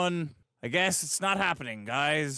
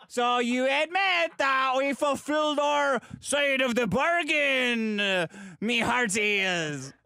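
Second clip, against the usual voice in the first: pirate voice; 5 semitones higher, slower than usual. Acted. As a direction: ham it up like a pirate captain. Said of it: "doing a fucking pirate impression".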